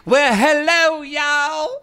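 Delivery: high, sassy drag queen greeting; campy drag queen. Pretend. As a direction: make a grand drag entrance. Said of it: "That sounds like a drag queen".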